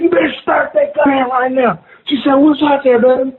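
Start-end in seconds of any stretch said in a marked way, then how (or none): 0.0-1.8 high, urgent retold demand; frantic order. Retold frantic order while fleeing. 2.4-3.3 imitated high female voice; high, anxious retold question; alarmed woman.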